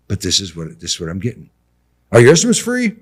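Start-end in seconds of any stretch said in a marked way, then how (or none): 2.1-3.0 mock caller. Mimicking callers asking.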